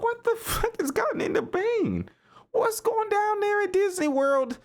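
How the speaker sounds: breathless giggling outrage; laughing disbelief. Retold or imitated. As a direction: squeak it out, cracking up at the replay. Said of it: "while I'm laughing".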